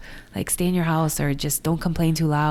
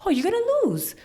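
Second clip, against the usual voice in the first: high, fast dismissive voice; mock blunt advisers; much faster than usual. Advisers dismissing Republican candidate, mock blunt. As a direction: wave off her chances. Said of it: "I'm gonna run as a Republican".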